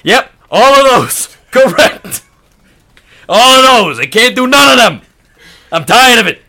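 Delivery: loud, high angry customer rant; yelling. Acted. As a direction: shout it out, fed up. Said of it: "Thanks for yelling".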